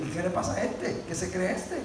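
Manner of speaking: low, hushed imitation of suspicious onlookers; mock disapproving aside. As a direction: act out puzzled critics under the breath. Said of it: "miran a uno medio raro, como queriendo decir". (murmullo de desaprobación imitado)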